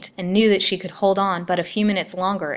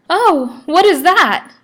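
a much higher, much louder cry of horror rising to a scream; horrified shriek; 12 semitones higher, 13 dB louder, slower than usual. Acted. Lily waking and seeing old Peter, shrieking in horror. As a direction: recoil screaming at the monstrous face. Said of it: "regarded him with looks of horror".

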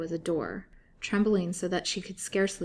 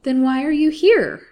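much higher, much louder urgent question; shocked question; 8 semitones higher, 14 dB louder than usual. A narrator's reading. Lily pressing the wife in disbelief, shocked. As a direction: demand it in disbelief.

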